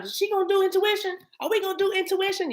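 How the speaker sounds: put-on high, eager child-like voice quoting Zia; Zia's eager voice. Telling viewers how Zia always asks, high and eager. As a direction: pitch way up to play the excited asker. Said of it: "Zia asks all the time".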